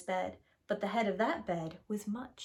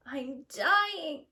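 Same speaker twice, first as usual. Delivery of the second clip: crying out; 6 semitones higher than usual. Acted. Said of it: "cried Baby Bear".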